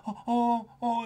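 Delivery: very high, cooing concern; concerned little kid. Retold or imitated. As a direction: imitate a child suddenly full of sympathy. Kid suddenly turns sympathetic.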